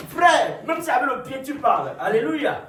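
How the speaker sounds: high, loud call to the congregation; loud exhortation. Real, not acted. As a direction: urge them on at full voice. Exhorts after laughing. (exhortation à pleine voix)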